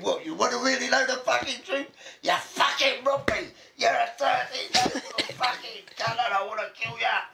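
high-pitched mock furious yell, laughter creeping in; imitated angry outburst. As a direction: act out his rant, voice shooting up. Acted angry outburst in retold quarrel.

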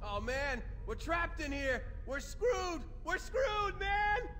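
very high-pitched panicked wail; rising, frantic despair; panicked bellyaching. Acted. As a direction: whine in panic like a trapped soldier. Trapped marine panics, told off after.

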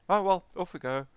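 mock breezy resignation. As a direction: toss it out as a soldier's last quip. Retelling soldiers' resigned line at charge.

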